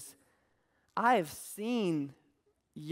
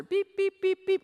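high, loud, rapid electronic beeps; fast high beeping; 11 semitones higher, 5 dB louder than usual. Retold or imitated. Imitating the dog's shock collar warning, high rapid beeps. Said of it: "it would beep faster".

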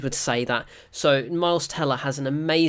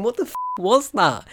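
pitched-up, incredulous outburst; retold astonishment; 7 semitones higher, 4 dB louder than usual. Viewer thinking the same as pilot, astonished. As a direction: echo the character's shock as your own. Replaying own shock at the same scene.

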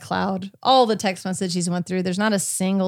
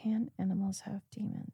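a hushed, breathy whisper echo; 15 dB quieter than usual. Pretend. Host echoes her co-host's question in a whisper. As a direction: whisper the question back in mock dread.

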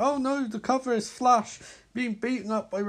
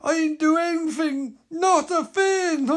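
much higher, louder mock-crying plea; hammed-up cry; 10 semitones higher, 5 dB louder than usual. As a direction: beaten hero mock-sobbing his innocence. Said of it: "Barry spits blood".

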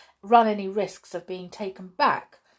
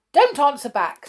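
loud, higher sharp rebuke; snapped; 7 semitones higher, 8 dB louder than usual. A narrator's reading. Judge silencing the driver, sharp snap. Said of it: "snapped the judge".

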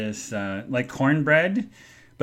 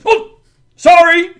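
high, loud, slow sorry; a caught-off-guard server apologizing; startled apology; 14 dB louder, slower than usual. Retold or imitated. Server apologizing to the angry customer, high slow. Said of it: "And the guy goes".

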